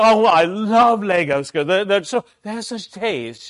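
a dad playing a grand English gentleman at his daughter's pretend tea, high and loud; hammed-up English tea guest. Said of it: "And I go".

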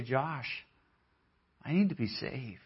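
soft confession of need; hushed confession. Retold or imitated. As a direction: say it quietly, almost ashamed. Confessing to a friend on the phone.

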